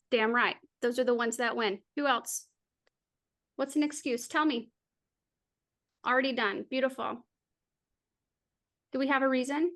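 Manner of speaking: ordinary talk, nothing marked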